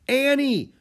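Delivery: high, loud shout of her name; alarmed cry. A narrator's reading. Boy calls to girl as rocks crash down, crying out. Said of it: "cried Jack".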